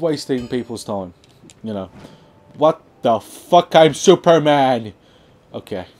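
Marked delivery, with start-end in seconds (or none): 2.6-4.9 slow, loud, higher exclamation; exclaiming loudly. Real, not acted. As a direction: belt it out word by word. Mock outburst at the game.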